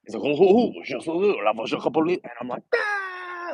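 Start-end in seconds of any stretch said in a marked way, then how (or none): 0.2-2.2 hammed-up French gibberish, high opening hoots; comic French accent, quick and playful. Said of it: "Dad started speaking in a French accent". 2.7-3.5 put-on high-pitched little-kid squeal; high, soft mock child squeal; four-year-old. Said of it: "like a four-year-old".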